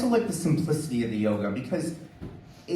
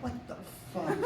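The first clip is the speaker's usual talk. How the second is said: soft breathless laughing what the fuck; half-laughing in disbelief at the old lady; quiet laughing disbelief at a smoker outliving him; stunned laughing disbelief; 11 semitones higher, 12 dB quieter than usual. Retold or imitated. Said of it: "and I'm like".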